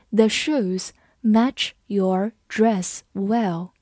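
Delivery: very slow, each word spaced out; slow read. Shown as a demonstration. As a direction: model it slowly for students. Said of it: "我们还是慢读一遍". (放慢语速逐词朗读)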